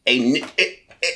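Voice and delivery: blocked, stuttering attempts at a name; demonstrated stutter. Shown as a demonstration. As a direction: get stuck on short, broken syllables before the name. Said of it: "talk like this. I used to be like".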